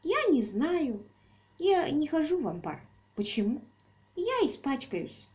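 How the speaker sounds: put-on high, slow childish voice; little girl voice. A narrator's reading. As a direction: pout like a tiny girl.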